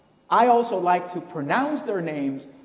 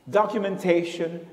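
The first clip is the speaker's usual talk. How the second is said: normal talking voice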